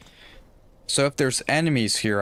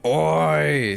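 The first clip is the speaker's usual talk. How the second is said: mocking Oi; 6 semitones higher, 4 dB louder than usual. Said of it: "He's always saying".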